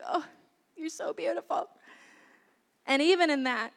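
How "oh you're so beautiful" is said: put-on soft, higher-pitched cooing over a baby; adoring coo. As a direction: coo softly at your sleeping child. Mother cooing over sleeping baby.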